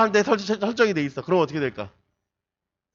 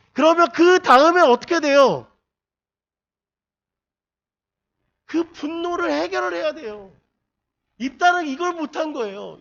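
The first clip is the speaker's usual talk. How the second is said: high, loud impassioned sermon; preaching at full force; 9 semitones higher, 7 dB louder than usual. Acted. Pastor exhorting the congregation, impassioned and loud. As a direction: drive it home like a fiery preacher.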